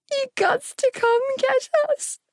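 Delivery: put-on very high, pleading little-boy voice; crying child. A narrator's reading. Little boy begging to be fetched, crying down the line. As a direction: cry it out as a frightened child. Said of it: "he cried down the line".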